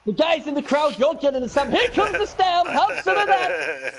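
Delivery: high, gleeful mock taunt. Real, not acted. Taunting the crowd as his player stamps, gleeful. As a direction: crow it like a happy bully.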